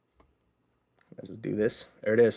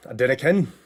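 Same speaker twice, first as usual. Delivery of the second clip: louder, falling on again; punchy catchphrase; 3 semitones higher, 6 dB louder than usual. Shown as a demonstration. Streamer repeating the line, now out loud. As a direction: announce it with a punch.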